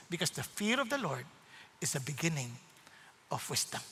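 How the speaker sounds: soft, low fatherly voice; God as a loving father, soft and low; tender fatherly. Retold or imitated. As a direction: gently warn a child you love.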